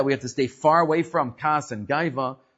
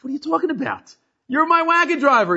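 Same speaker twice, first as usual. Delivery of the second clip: much higher, louder mock outrage; brazen indignation; 12 semitones higher, 3 dB louder than usual. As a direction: bluff it out, ordering the boss around. Acted quarrel.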